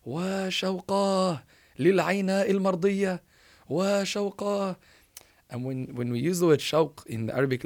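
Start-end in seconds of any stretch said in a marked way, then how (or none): 0.0-3.2 drawn-out, high, loud cry of longing; retold joyful scream. Boy wakes at night longing for Al-Ayna, retold joyful scream. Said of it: "He starts screaming out of happiness". 3.7-4.8 very drawn-out, high, loud cry; retold joyful scream. Boy cries out his intense longing, retold joyful scream. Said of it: "He starts screaming out of happiness".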